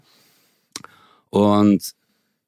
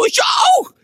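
much higher, shouted squeal of joy; much higher and louder, overjoyed shriek; ecstatic shout; 3 dB louder than usual. Retold or imitated. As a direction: scream it like you just won. Same village, peak excitement.

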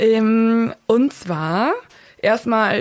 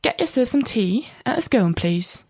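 put-on British accent. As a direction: lay the British accent on thick. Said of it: "mit so einem richtig fetten britischen Akzent".

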